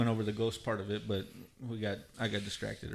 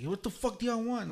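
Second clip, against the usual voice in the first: pissed-off parents; 11 semitones higher, 3 dB quieter than usual. Retold or imitated. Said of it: "they're all pissed off".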